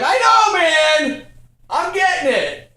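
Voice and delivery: high, loud mock protest; acted frustrated shout; exasperated yell back. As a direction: holler back while busy mopping. Acted yelling back at Tony.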